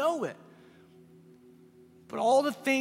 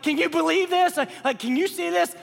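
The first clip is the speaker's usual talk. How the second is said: amazed crowd; 7 semitones higher, 5 dB louder than usual. Retold or imitated. Stunned crowd at Lazarus rising.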